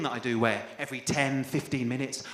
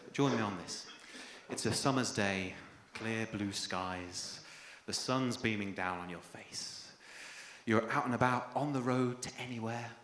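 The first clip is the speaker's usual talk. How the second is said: guided visualisation; 5 semitones lower than usual. Acted. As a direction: lull them into a calm daydream.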